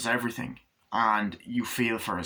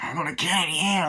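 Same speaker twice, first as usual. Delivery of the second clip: gloating thug; 7 semitones higher, 3 dB louder than usual. Acted.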